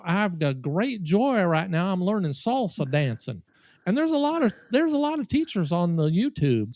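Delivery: lilting Southern enthusiast; loud Southern accent. Retold or imitated. Diner telling friends about salsa dancing in a Southern accent. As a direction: imitate a Southerner holding forth to the room. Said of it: "talking so loud in this Southern accent".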